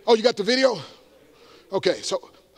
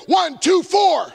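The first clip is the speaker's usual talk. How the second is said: loud rising count-off, higher and louder; shouted count; 8 semitones higher, 4 dB louder, much slower than usual. Real, not acted. Preacher counting off to the crowd, shouting.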